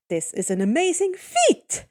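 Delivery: ordinary delivery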